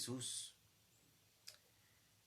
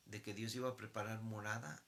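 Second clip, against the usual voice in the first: ordinary delivery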